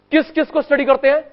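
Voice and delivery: asking again with growing impatience; impatient repeat. Real, not acted. Teacher repeats unanswered question.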